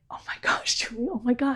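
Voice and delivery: breath-filled whisper of amazement; retold joyful hush; whispered wonder. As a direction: whisper as if almost soaring. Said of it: "like he was almost soaring".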